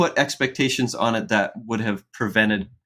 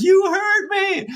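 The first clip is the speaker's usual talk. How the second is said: put-on high, slow, wailing child's complaint; dad voicing his crying son, high and drawn out; 4 dB louder, slower than usual. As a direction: imitate a small boy sobbing out his hurt. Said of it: "he is crying big tears".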